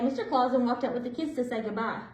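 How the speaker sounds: usual speaking voice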